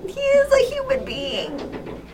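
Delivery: high, shrill disbelief trailing off; mock outrage. Real, not acted. Listener protests the kitten nickname in mock outrage. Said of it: "outraged at the fic".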